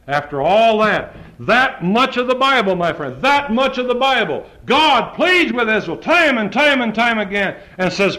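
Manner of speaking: high, loud preaching at full force; fiery preaching. Acted. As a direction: thunder the scripture at the congregation.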